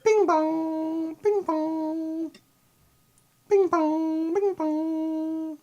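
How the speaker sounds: high, sung two-tone doorbell chime. Retold or imitated. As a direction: imitate an electronic doorbell ding-dong. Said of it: "It's just going".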